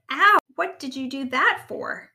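loud, pained protest; yelp, then complain; indignant yelp. A narrator's reading. Rabbit tripped by the frog's tongue, indignant.

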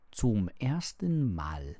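plain reading voice

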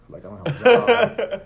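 exasperated laugh; laughing frustration. Retold or imitated. Frustrated, rejected from job.

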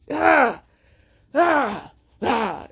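exasperated "ah" bursts, dropping off; frustrated cries. Real, not acted. Fed up with this world, frustrated cries. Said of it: "It's frustrating".

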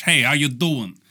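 ordinary talk

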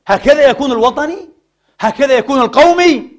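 loud, high rhetorical questions; indignant challenge. Real, not acted. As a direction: throw each question out as an accusation.

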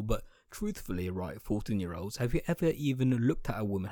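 ordinary talk, nothing marked

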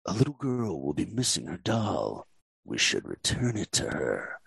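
low, menacing villain growl; Shan Yu villain voice. Shown as a demonstration. Director demos his temp villain line, low and menacing. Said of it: "I'll give you guys one of the lines".

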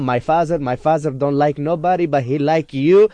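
put-on high, pleading East African accent; imitating female fan's accent. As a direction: imitate an Eritrean woman's gushing declaration in accent. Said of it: "she was like".

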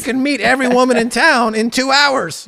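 high, loud and carried away; excited pitch. Real, not acted. Host promising lonely men quick romance, excited pitch. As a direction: hype it like a can't-lose offer.

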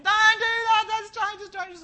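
put-on very high, loud frantic yell at the child; yelling warning. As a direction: shriek the warning racing at them. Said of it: "you could race at them yelling".

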